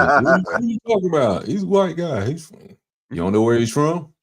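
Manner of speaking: high, put-on voice of baffled people; mock disbelief about Elon Musk's origins; incredulous retort. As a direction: act out people asking in disbelief.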